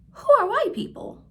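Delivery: put-on high, quick little-kid voice; child's question. Teacher voicing a child asking about race, high and quick. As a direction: pitch way up like a curious small child.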